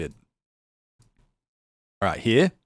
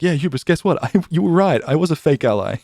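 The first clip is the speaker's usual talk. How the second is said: high, loud teasing reveal; gleeful confession; 8 semitones higher, 7 dB louder than usual. Retold or imitated. Streamer tells troll he was right, high and gleeful. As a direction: announce it with a grin. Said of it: "I was like".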